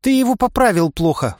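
put-on high, reproachful girl's voice; indignant. A narrator's reading. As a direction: blame him indignantly. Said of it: "возмутилась она". (возмущённо, высоко)

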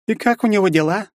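high woman's voice asking pointedly; haughty mother. A narrator's reading. Mother asking her son about the hero, high and haughty. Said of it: "почему-то не напрямую меня".

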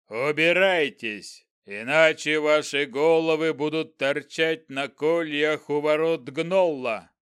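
slow booming goblin threat; dark lord. Acted. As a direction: threaten them like a giant goblin. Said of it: "громогласно произнёс он".

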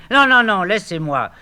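high, loud refusal; loud protest. Acted. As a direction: shout him off. Orphée refuses to stay.

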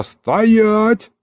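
high-pitched yelled order; yelling. A narrator's reading. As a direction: holler it as a halt command. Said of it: "заорал я".

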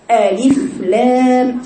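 مد "ألف لام" ببطء مبالغ فيه; wrong drawn reading. Shown as a demonstration. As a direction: stretch ألف لام far too long. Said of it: "البعض يقرأها هكذا".

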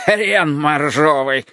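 put-on slow, snorted drunken insult; snorting drunk. A narrator's reading. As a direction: spit it out slowly, sneering. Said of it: "фыркнул он".

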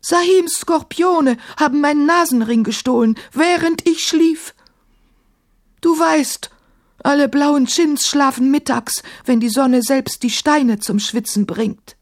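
high-pitched, wounded self-defence; hurt, protesting djinn voice; aggrieved djinn. A narrator's reading. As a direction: plead innocence as an offended djinn. Offended djinn explains himself.

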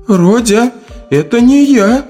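high-pitched agitated female character. A narrator's reading. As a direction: plead nervously in a high voice. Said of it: "взволнованно говорит обнажённая Люда". (взволнованно, высоким голосом)

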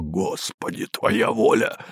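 hoarse quiet rasp. A narrator's reading. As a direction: rasp it out in dread. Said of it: "незнакомый голос прохрипел".

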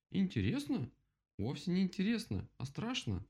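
high-pitched incredulous rabbit; objecting. A narrator's reading. Rabbit contradicts the woodpecker, objecting. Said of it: "возразил кролик".

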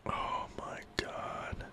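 breathless stunned whisper. Real, not acted. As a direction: gasp it out under your breath. Stunned by a personal question.

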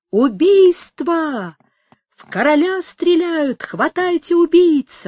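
loud, high, drawn-out shouts of alarm; crowd's cry. A narrator's reading. Crowd raising the alarm after shots at the king, shouting. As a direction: cry it out slowly to the whole street. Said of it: "с громкими криками народ".

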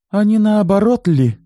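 high, loud taunting question; mocking. A narrator's reading. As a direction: needle her with the question. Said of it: "с издёвкой спросила Юля". (с издёвкой, высоко и громко)

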